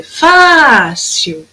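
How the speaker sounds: "fácil" with a long, emphatic fá; FÁ forte e esticado. Shown as a demonstration. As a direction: call out "fácil", holding the first syllable. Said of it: "Que eu falo com mais intensidade". ("fácil" com o fá bem comprido)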